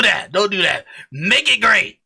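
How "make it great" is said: high, loud mocking slogan. Parodying a campaign slogan, high and loud. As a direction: punch it out like a parody.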